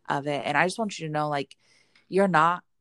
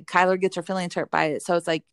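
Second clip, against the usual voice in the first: ordinary talk, nothing marked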